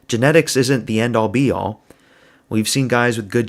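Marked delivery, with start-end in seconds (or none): none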